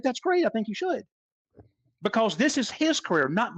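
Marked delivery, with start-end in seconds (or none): 0.0-1.0 cheerful approval. Retold or imitated. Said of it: "I said".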